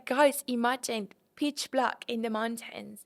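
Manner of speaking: ordinary talk, nothing marked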